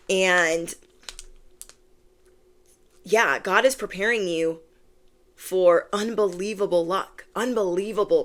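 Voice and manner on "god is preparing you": usual speaking voice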